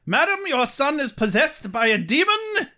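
high, hammed-up proclamation; mock-grave announcement, pitched high; dramatic declaration. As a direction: declare it like a melodramatic hero. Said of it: "Angel jumps up and declares".

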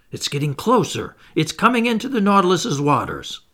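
excited exclaiming. A narrator's reading. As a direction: call out as the quarry nears. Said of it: "exclaimed the Canadian".

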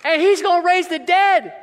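loud, slow proclamation; rising preacher's cry; preaching shout. Real, not acted. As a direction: shout it out to the church.

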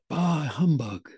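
slow, drawn-out grumpy catchphrase; Scrooge. Acted. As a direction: draw it out like a stage miser. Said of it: "to play Scrooge".

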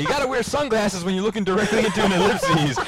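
high, loud, laughing mimicry; imitating Robert D. Co-host retelling a podcaster's joke about ellipses, high and laughing. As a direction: crow it through laughter. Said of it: "then Robert's like".

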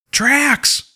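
high-pitched call at ordinary volume; urgent call. Acted. Parker calling into his helmet, urgent high call. Calls crewmate into helmet after screeching.

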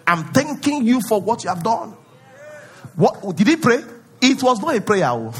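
ordinary delivery

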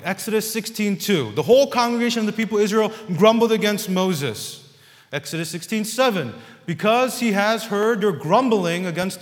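loud, high, driving sermon reading; fiery preacher's proclamation; preaching at full force. Acted. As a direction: thunder the verses at the congregation.

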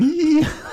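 high mischievous "hee" giggle; imitated giggling. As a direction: giggle like a plotting prankster.

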